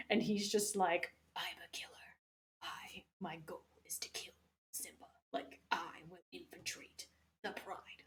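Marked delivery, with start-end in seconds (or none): 1.4-8.0 hushed, breathy villain whisper; secret whisper. Retold or imitated. Kovu's secret plan retold.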